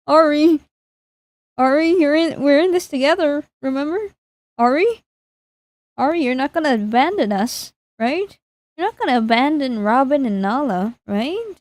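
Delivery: mock-anxious coaxing with rising questions; playful pleading. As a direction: plead with a friend, lifting each check upward. Pleads with game character not to leave.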